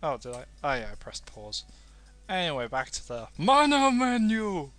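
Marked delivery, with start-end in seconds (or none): 2.3-4.7 high, loud grand proclamation; mock-epic menu announcement; over-dramatic announcement. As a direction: boom it out like a trailer voice. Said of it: "over-dramatizing for some sort of effect".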